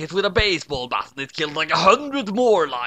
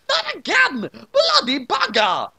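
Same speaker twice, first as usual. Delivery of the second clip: high, outraged dying yelp; high cry; 4 dB louder than usual. A narrator's reading. Watery being shot, crying out. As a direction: wail it as you die again.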